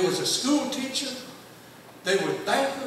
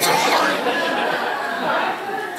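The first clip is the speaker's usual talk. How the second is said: real laugh; 10 semitones higher, 6 dB louder than usual. Real, not acted. Laughing at the memory.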